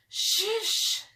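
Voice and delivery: hushing. Acted. Jack hushes Annie so nobody hears them. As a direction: hush her up quickly. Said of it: "said Jack".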